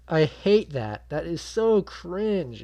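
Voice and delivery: loud, high, drawn-out disgust at the cringe; pained cringing. Real, not acted. Viewer hating that awkward line, pained loud cringing. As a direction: stretch the words out in exasperated agony.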